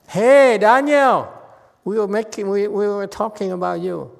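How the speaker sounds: high, loud call across the room. Real, not acted. Teacher calling out to Daniel in class, high and loud. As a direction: call out to get his attention.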